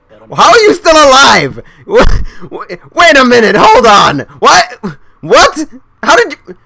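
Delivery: high-pitched, baffled outburst; shocked disbelief. Real, not acted. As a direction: demand answers, stunned and high. Shocked that character is alive.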